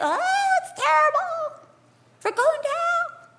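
high-pitched, wailing mock alarm; mock panic. People overwhelmed by circumstances, high hammed-up panic. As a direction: cry out doom in hammed-up panic. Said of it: "sometimes we get".

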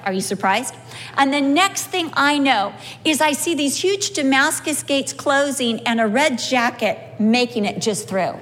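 ordinary talk

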